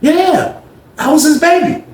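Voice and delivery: very high, coy and proud; Greg's high proud voice. Retold or imitated. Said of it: "He was like".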